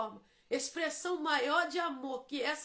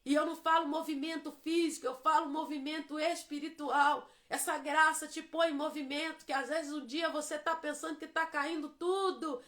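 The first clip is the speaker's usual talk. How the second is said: ordinary talk, nothing marked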